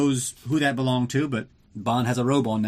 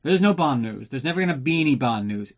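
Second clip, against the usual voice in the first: normal talking voice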